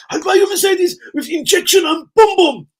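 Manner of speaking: very high, loud falsetto; high-pitched mock excitement; squeaky put-on voice. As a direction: promise it in a loud comic squeak. Comic put-on promise, falsetto.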